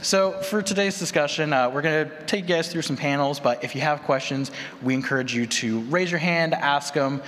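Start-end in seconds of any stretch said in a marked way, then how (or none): none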